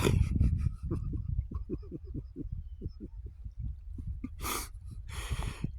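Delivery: long, breathy, helpless laugh; wheezy laughter. Real, not acted. Storyteller cracks up at his own prank on a fellow inmate, wheezy laughter. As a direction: laugh quietly till the breath runs out.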